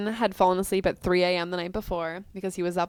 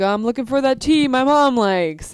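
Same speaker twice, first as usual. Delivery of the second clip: nervous excuse; 7 semitones higher, 7 dB louder than usual. Retold or imitated. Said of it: "He's like".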